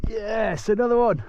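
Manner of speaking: high, delighted celebration; triumphant cheer. Real, not acted. Hooks another fish.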